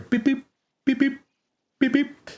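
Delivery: high-pitched imitated monitor beeps; heartbeat monitor beeps. Game master voicing the heartbeat monitor, high imitated beeps. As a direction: beep in short, even pairs, high up.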